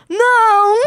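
put-on high, drawn-out child's answer; Valentim. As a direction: imitate a little boy innocently admitting it. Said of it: "Você sabe o que que é maduro?".

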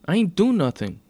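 loud, high indignant denial; defensive protest. A narrator's reading. Accused teen denies it to officer.

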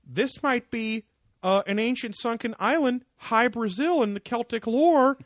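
high, mocking breathless conspiracy voice; David Wilcock. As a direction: ham it up like an excitable guru.

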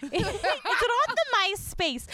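hammed-up old person's puzzled question; co-host joins the joke about being old, mock-baffled oldster; mock clueless oldster. Lightly put-on baffled elder.

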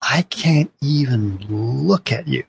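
slow, drawn-out contempt in imitation; visceral hate. Wife telling husband she despises him, slow hateful scorn. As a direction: stretch each word with scornful disbelief, without shouting. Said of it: "she says it with such visceral hate and laughter".

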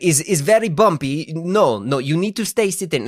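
mock Italian accent, firmly refusing. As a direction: imitate an insistent Italian woman in broken English. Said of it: "she tries to speak Italian to me".

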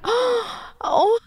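gasp, then a high little "oh"; high, touched gasp; re-enacted moved gasp. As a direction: act out an overwhelmed gasp. Acts out moved reaction to proposal.

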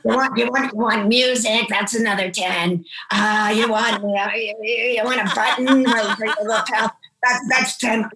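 imitating the chapel woman's odd voice; chapel woman impression. Chapel woman pitching wedding extras to the couple, imitated voice. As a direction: put on her odd sales-patter voice. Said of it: "She talks like this".

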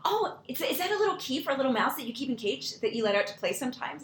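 put-on high, bright child's chatter; imitating a curious small child; enthusiastic little girl. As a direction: ask with wide-eyed enthusiasm. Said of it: "such enthusiasm and brightness".

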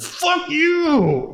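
high-pitched, drawn-out retold curse; defiant outburst. Man cursing the bank teller who humiliated him, defiant. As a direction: send the curse way up high, acting out fury.

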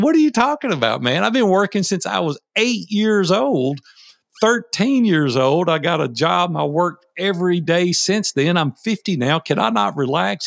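high, fast, incredulous pushback, retold; indignant protest. Retelling his indignant reply to Dan, high and incredulous. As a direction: voice leaps up in disbelief, then presses the case.

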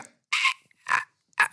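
short, very high, squeezed yelps of pain. Retold or imitated. Host retelling his stitches being grabbed, pained high yelps. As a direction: re-enact speechless pain in tiny high yelps.

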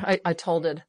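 ordinary talk, nothing marked

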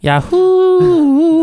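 high, loud jingle-style Yahoo!; Yahoo yodel. Pretend. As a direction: belt it like the old ad cry. Shouting brand jingle mockingly.

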